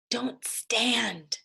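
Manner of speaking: urgent whisper. Acted. Jack whispers a warning to Annie beside the huge dinosaur. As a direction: hiss it so the dinosaur won't hear. Hushing sister near dinosaur.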